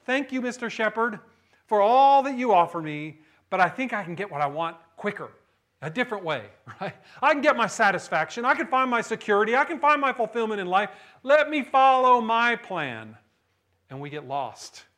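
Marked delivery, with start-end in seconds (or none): 0.0-6.5 loud, higher brush-off of the shepherd; the wandering believer turns down the shepherd with a loud, high brush-off; mock refusal. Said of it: "We decide". 7.2-13.2 louder, higher cocky claims; the stubborn believer boasts, loud and cocky, of going his own way; self-reliant boast. Retold or imitated. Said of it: "We decide".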